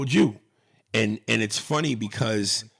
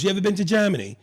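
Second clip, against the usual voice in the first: higher, lightly British question; British accent; 7 semitones higher, 3 dB louder, much faster than usual. Retold or imitated. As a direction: imitate a curious British director, pitched up. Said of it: "in that British accent".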